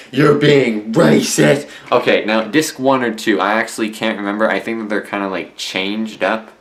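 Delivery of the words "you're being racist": loud, higher mock outcry; hammed-up classmate accusation; accusing classmates. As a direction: imitate classmates calling someone out. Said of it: "classmates at my school are like".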